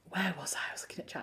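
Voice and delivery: very soft, whispery self-talk; whispered aside. Real, not acted. Finding her place in the chat, whispered aside. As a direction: whisper it to yourself.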